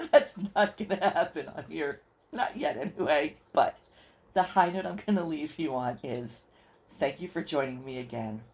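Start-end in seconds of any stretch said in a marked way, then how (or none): 0.0-3.4 laughing, louder; amused refusal through laughter. Real, not acted. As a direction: laugh off the idea of singing. Laughs off the idea of singing.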